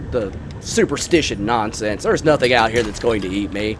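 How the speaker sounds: ordinary delivery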